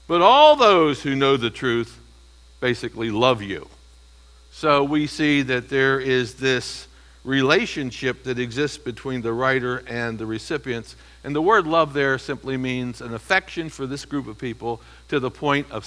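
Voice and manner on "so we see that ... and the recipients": normal talking voice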